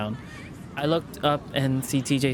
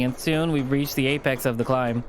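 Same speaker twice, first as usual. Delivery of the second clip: usual speaking voice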